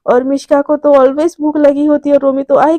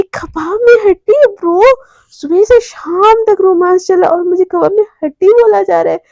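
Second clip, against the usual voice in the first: mock indignation; 4 semitones higher than usual. Romi teasing Mishka about the romance, mock indignant. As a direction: toss the insult back, teasingly affronted. Friend indignantly throws the insult back.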